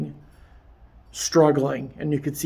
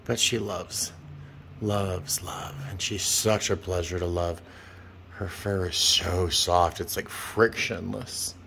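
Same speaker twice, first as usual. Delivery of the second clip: soft adoring murmur; 7 semitones lower, 7 dB quieter than usual. Real, not acted. Petting cat, soft adoring talk.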